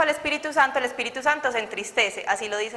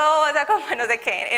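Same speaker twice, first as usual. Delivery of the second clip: ordinary talk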